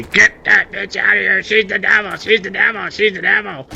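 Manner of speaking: high, chanting accusation; accusing family. Pretend. Family ganging up on the girlfriend, high chanting accusation. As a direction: chant it like a mob.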